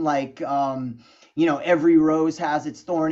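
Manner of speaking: ordinary delivery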